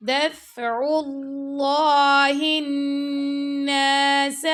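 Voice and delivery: slow, melodic tajweed chant; teacher chanting a verse for students; Quran recitation. Shown as a demonstration. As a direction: recite it slowly with full tajweed.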